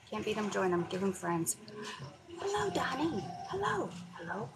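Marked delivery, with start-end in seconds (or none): 2.4-3.9 high excited greeting. Real, not acted. Greeting a viewer in chat. 4.1-4.5 hushed whispered greeting; whispering on the phone. Real, not acted. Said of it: "I have to whisper".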